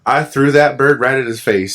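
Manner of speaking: ordinary talk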